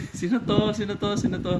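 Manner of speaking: high-pitched, repeated baffled question; amused bafflement. Real, not acted. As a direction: ask it high, over and over, genuinely stumped. Cannot recognize the drawn card. (mataas ang boses, takang-taka)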